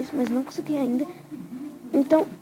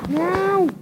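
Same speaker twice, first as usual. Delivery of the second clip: sudden loud cry of dismay; panicking gamer; 7 semitones higher, 4 dB louder than usual. Real, not acted. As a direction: shout it as you lose. Loses the game, exclaims. (grito de desespero do jogador)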